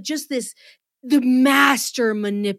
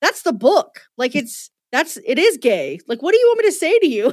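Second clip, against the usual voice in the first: disdainful actor; 5 semitones higher, 6 dB louder than usual. Retold or imitated. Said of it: "Sam Reed is like".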